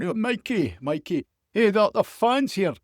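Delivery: high, loud Scottish reporter pleading; Scottish accent. Acted. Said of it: "some of my Scottish accent here".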